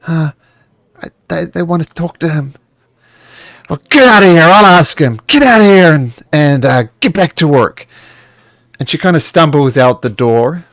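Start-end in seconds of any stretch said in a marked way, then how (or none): 0.1-2.5 higher, nervous, stammering little voice; a mild hint of a frightened girl; meek servant girl stammering to her master; timid servant. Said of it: "the quiet voice of the tiefling". 3.7-7.9 loud, high, snarling orders; angry shouting. Acted. Master shouts at servant.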